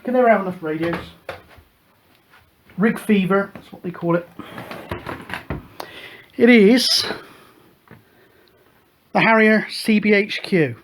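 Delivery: normal talking voice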